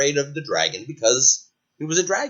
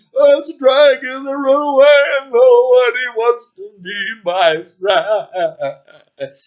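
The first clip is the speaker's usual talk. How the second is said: loud, high wailing lament; sad dragon; 10 semitones higher, 8 dB louder than usual. Acted. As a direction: complain tearfully, nobody likes me. Dragon lamenting, about to cry.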